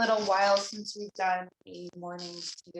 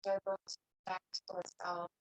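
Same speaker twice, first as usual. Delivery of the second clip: soft, unhurried meditation voice with long pauses; guided meditation; 8 dB quieter than usual. Real, not acted. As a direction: guide them gently into stillness, leaving long silences. Leads a seated meditation.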